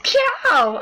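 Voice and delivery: high, loud shout in a put-on Italian accent; attempted Italian accent. Game player voicing an Italian greeting, high attempted Italian accent. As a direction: call it out big with a hammed-up Italian accent. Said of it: "I just can't do the voice".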